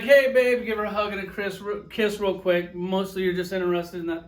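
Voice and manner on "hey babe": loud, higher happy hello; mock-excited hey babe; eager greeting. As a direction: call out to her as she walks in. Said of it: "you're like".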